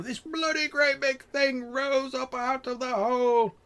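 very high, stretched-out rustic wail; awestruck tale-teller. Acted. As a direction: build to a high, drawn-out climax. Trapper's tale reaches its climax again.

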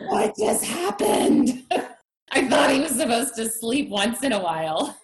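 hammed-up bewildered wail with a laugh; mock exasperation. Retells exhausted new parent's disbelief.

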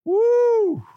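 high, shrill whoop of triumph; mock cheer. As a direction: whoop like a delighted god. God cheering over flood.

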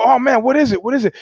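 hammed-up eagerness; mock eager.

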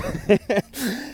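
high laugh; burst of laughter. Real, not acted. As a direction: crack up at yourself. Laughing at own rudeness.

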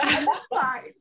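forced laughing I'm fine; choked up, laughing it off; shaky sigh. Real, not acted. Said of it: "I'm trying not to cry".

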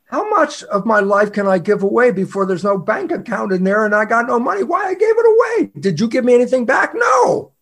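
indignation climbing to a high shout; mock outrage. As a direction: build from protest to a loud, high-pitched NO. Acted outrage at giving away.

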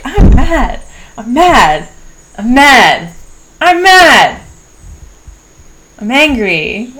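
loud, high angry shouts, building then easing; re-enacted anger. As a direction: yell each declaration as a separate release of anger. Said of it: "just letting myself be mad".

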